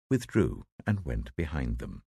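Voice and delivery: usual speaking voice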